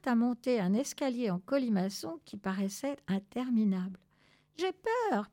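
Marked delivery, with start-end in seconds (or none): 4.6-5.3 high, small scared chirp; piping fear. Acted. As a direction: peep it out like a frightened chick. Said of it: "pépia Afortunada". (petite voix apeurée très aiguë)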